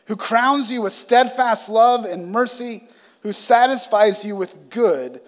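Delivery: proclaimed reading, rising then settling; scripture reading. Acted. Pastor continues the psalm for the congregation, proclaiming. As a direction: read the verses out to the church.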